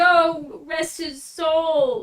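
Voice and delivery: high, loud, drawn-out mock lament; hammed-up mourning, stretched slow; imitating mournful onlookers. Adventurer mimicking people lamenting the cave-goers, mock mournful. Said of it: "all of the other people were like".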